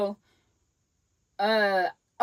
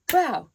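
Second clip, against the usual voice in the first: high, loud mock punch noise; imitated punch sound; 8 semitones higher, 5 dB louder than usual. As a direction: pop out a high "bow".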